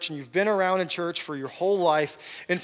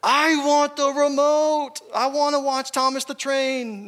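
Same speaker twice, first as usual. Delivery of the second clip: put-on high, loud, slow whiny toddler demanding the remote; 7 semitones higher, 4 dB louder, slower than usual. Preacher voicing his toddler son wanting the remote, whiny and demanding. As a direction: drag it out like a spoiled little kid who wants the remote. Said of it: "the fourteen-month-old child version of you said".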